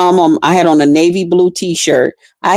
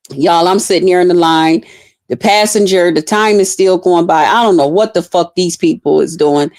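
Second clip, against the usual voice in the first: ordinary delivery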